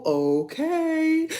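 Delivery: high sassy okay; mock sassy okay. Reacting to ratchet Bible stories, high sassy okay.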